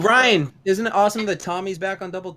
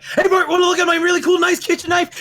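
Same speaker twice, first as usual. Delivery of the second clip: much higher and louder goofy dad voice; a cartoon dad voice, pitched way up and loud; hammed-up Homer; 8 dB louder than usual. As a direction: play the cheerful, oblivious dad offering a knife. Homer voiced in Simpsons retelling.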